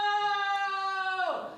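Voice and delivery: long high sung-out "No!"; anguished scream. Retold or imitated. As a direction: wail it high and loud. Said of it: "says Alec Guinness Junior".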